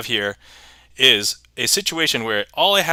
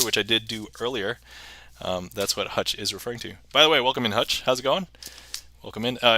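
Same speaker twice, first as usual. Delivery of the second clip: usual speaking voice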